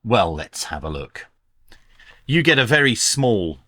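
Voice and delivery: ordinary talk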